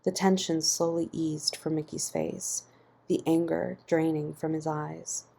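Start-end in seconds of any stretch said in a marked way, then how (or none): none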